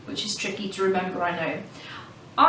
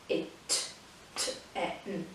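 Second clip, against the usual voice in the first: whispered K-it-t-e-n, each piece slow and separate; soft, slow syllable split; broken into syllables; 10 dB quieter than usual. Shown as a demonstration. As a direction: stretch K-it-t-e-n out in parts. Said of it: "that's where we break it up".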